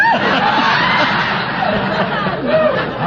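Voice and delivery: big high laugh bursting out; loud laugh. Real, not acted. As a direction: let out a high shouted laugh. Big laugh after the joke.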